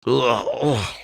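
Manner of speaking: acted groan of someone coming round. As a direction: groan as if just knocked out. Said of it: "start to groan".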